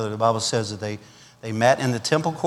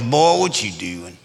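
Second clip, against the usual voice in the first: lightly voiced slow country drawl; low, lazy Southern cop; 5 semitones lower, slower than usual. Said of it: "country redneck type guy".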